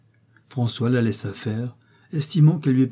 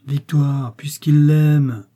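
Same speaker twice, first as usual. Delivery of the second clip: louder, drawn-out plea; emboldened; 4 semitones higher, 7 dB louder, much slower than usual. A narrator's reading. Father siding with his son to his wife, emboldened. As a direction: plead up, stretching the words out. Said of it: "enhardi par ce".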